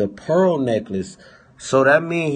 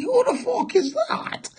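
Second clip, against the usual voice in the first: mock whine. Mock voice asking who he is.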